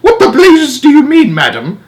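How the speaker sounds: high, loud outraged shout; furious father shouting at the old woman. Acted. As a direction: bellow it in disbelief. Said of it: "shouted Mister Jenkins".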